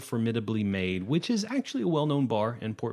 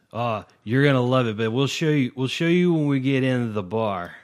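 put-on loud drunk; 2 semitones higher, 6 dB louder than usual. Drunk brushing off the question.

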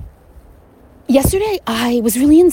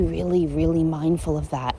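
normal talking voice

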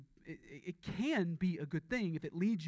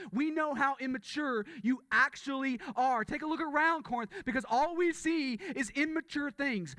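rising, louder preacher's rebuke, voice climbing higher; preaching at full force; 7 semitones higher, 4 dB louder than usual. Acted. Preacher rebuking the immature Corinthian church, preaching at full force. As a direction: thunder it from the pulpit like a prophet scolding.

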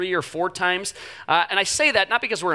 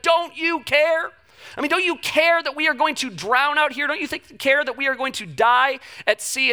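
panicked plea; 8 semitones higher than usual. Retold or imitated. Disciples pleading with Jesus in the storm, mock panic. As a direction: beg like you're about to drown, retold. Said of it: "and they're in a panic".

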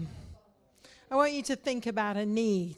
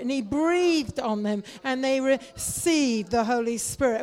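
louder, building prayer; fervent prayer; 5 dB louder than usual. Real, not acted. Pastor leading the church in prayer, building loud. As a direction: pour it out, growing in force.

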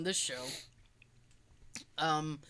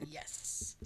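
whisper; 15 dB quieter than usual. Real, not acted. Aside after joke.

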